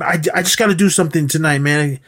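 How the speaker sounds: put-on antsy fast-talking kid. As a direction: gush it out in a hurry. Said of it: "he was just talking so fast".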